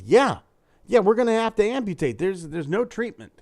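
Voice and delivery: a blunt doctor hurries through the bad news; doctor confirming.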